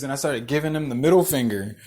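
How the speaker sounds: usual speaking voice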